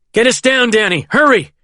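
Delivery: high, pressing command; urgent shout. A narrator's reading. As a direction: shout at the pilot to hurry. Urging pilot to descend fast.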